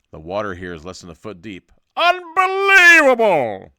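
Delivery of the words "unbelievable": very loud, high, drawn-out yell; incredulous shout. Acted. Narrator amazed at the shallow water, incredulous shout. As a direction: bellow it slowly in hammed-up disbelief.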